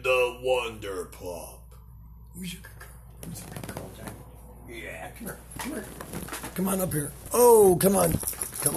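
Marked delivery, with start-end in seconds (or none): 2.3-5.8 whispering. Real, not acted. Whispering to the dog.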